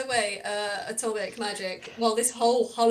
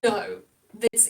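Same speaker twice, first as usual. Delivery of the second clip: ordinary talk, nothing marked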